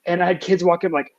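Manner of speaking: ordinary delivery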